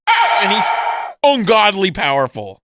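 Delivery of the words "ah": high shrieking mock roar; a loud high cartoon yell; shouted villain roar. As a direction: yell it out like a startled monster. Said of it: "he's like".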